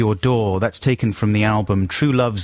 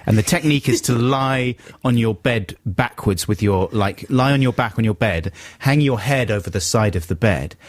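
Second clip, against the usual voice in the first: ordinary talk, nothing marked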